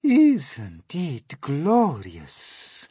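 high, very slow swoon; dreamy spider lady; Miss Spider voice. Acted. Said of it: "Miss Spider said".

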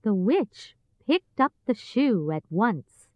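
ordinary delivery, nothing marked

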